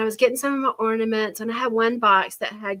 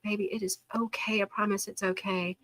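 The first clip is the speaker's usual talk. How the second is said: soft, soothing retold comfort; tender reassurance; 9 dB quieter than usual. Grandmother hugging hurt grandchild, soft tender reassurance. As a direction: comfort gently, as if hugging a child. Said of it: "and I'm hugging her".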